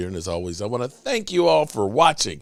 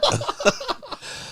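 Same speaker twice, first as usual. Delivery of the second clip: laugh; 5 dB quieter than usual. Real, not acted. Laughing at the catchphrase.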